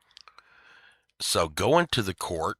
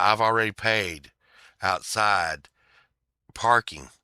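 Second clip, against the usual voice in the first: ordinary delivery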